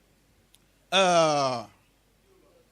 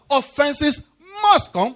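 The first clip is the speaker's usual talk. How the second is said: ordinary talk, nothing marked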